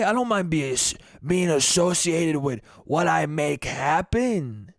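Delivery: put-on slow, leering old-man voice; slow creepy Italian old-timer. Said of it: "Just a creepy fucking Italian voice".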